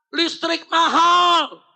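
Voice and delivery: loud, high, drawn-out complaint; stretched mock protest shout; protesting fathers' cry. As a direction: imitate men yelling in protest. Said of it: "protes juga".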